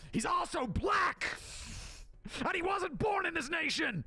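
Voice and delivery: hammed-up screaming pundit; mock outraged shout. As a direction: bellow the punchline in fake fury. Parody rant climax.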